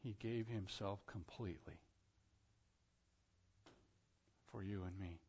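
hushed, low and unadorned; said simply. Real, not acted. Preacher putting it simply for the congregation, hushed. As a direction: drop right down and let it land softly. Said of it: "I'll just say it simply".

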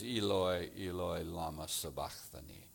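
put-on low, soft cry in a light Greek accent; Greek-accented quote. Preacher quotes Mark's words from the cross, Greek accent. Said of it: "Greek or international accent".

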